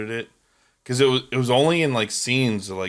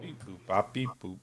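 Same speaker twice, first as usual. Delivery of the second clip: robot beeps; 10 dB quieter than usual. Retold or imitated. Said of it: "I am robot".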